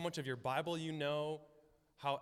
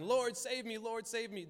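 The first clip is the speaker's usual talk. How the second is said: high, desperate plea; retold crying out; 7 semitones higher than usual. Two desperate blind men crying out to Jesus, high imitated plea. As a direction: imitate calling out to be saved. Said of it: "They're crying".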